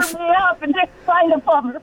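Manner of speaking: muffled, garbled phone line; like talking from underwater. Real, not acted. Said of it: "your phone sounds like you're underwater".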